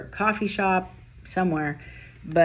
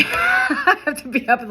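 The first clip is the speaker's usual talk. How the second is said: laughing through the words, much higher; giggling at herself; 13 semitones higher, 4 dB louder than usual. Real, not acted. Reader laughing about needing sleep, words giggled out.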